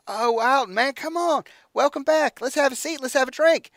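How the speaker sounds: high, loud call from far away; yelling from a tunnel. Acted. Captain Burton calling to Alton from a great distance. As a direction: shout it like it echoes down a tunnel. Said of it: "it sounds like he's yelling from a tunnel".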